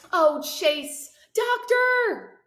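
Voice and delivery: high, loud, overjoyed call for the doctor; excited cry. Acted. Mother calls for the doctor as her son wakes, high and loud. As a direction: cry out for help, almost breaking with joy.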